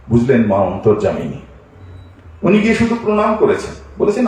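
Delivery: ordinary delivery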